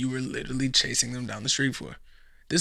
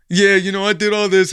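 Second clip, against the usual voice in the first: loud, higher mocking brag; mock bragging loser; 5 semitones higher, 9 dB louder than usual. Mocking a loser bragging, loud and higher. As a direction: show off like a loser. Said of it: "mocking a bragging loser".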